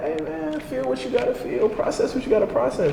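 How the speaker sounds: retold soft, high-pitched soothing; gentle reassurance. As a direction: softly coax him through his feelings. Soothing the crying man during poem.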